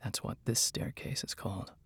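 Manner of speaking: very soft, whispery mage; whispering voice. A narrator's reading. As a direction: explain it in a hushed whisper. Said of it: "in his whispering voice".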